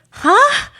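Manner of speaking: high, loud hammed-up huh; mock dramatic gasp-like huh; theatrical huh. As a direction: fake a theatrical outburst. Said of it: "why you're being so theatrical".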